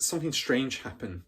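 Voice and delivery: plain reading voice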